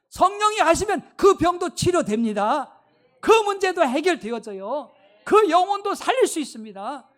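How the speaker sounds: loud, ringing preacher's declaration; fervent preaching. Acted. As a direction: proclaim it with full conviction to the congregation. Preaching at full force. (힘차게 외치는 설교)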